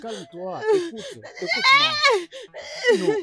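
frantic soothing. Acted. Husband begging his crying wife to calm down, high frantic soothing. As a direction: soothe her in a high panic.

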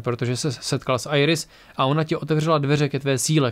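ordinary delivery

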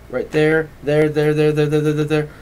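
rapid repeating. Acted. Mind jumping, repeated word.

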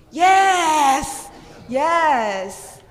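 normal talking voice

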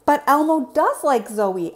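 high, loud little monster voice; Elmo insisting. Acted.

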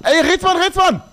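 high loud yell for a friend; yelling across the room. Real, not acted. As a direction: shout his name across the room. Yelling for teammate across map.

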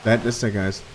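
ordinary talk

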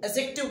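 "एडजेक्टिव" said as a-jective, without d; silent d. Shown as a demonstration. Teacher confirming what it is called, "एडजेक्टिव" with silent d. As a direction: go straight from a to j in "एडजेक्टिव". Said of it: "एडजेक्टिव कहा जाएगा इसको".

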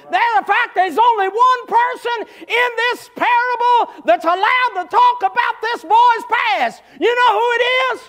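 loud, high revival preaching, shouted to the pews; preaching at full force. Acted. Preacher rebuking the congregation, shouted full-force preaching. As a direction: preach it hard to the church.

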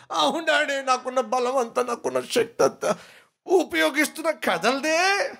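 put-on a small kid insisting he's trying; child's voice. Child answers father in story.

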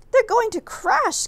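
high, slow frightened gasp; alarmed gasp. A narrator's reading. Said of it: "gasped Stellaluna".

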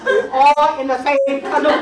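laughing. Real, not acted. Quoting grandpa's canoe saying while laughing.

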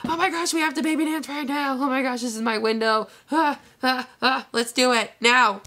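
hammed-up frantic eagerness; crazy psycho wife. Imitating herself as a crazy wife begging her husband. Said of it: "crazy psycho wife".

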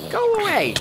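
high, loud go away; shout. Real, not acted. Squealing at a monster to go away. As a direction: shriek it at the mob.

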